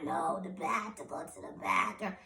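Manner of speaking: soft, faint, breathy protest; imitated weak patient pleading. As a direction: strain to get it out with hardly any voice. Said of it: "that's how I sounded".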